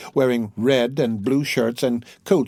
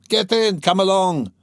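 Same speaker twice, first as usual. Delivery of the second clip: put-on drunken urging; 7 semitones higher than usual. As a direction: hurry them in with a rowdy yell. Drunk Mikolka urging crowd aboard.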